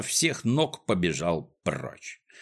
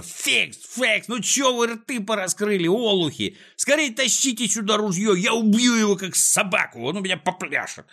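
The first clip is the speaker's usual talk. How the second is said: high-pitched furious orders; shrieking villain tirade; enraged yelling; 11 semitones higher than usual. A narrator's reading. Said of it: "орал Клопс, наливаясь от злости кровью".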